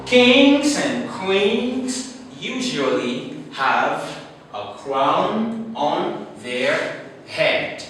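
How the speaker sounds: very slow start, stretched "Kings"; slow example read. Shown as a demonstration. Teacher reading the second example slowly. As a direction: draw out the sentence for learners. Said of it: "the second one is".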